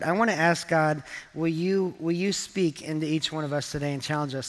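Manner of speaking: ordinary talk, nothing marked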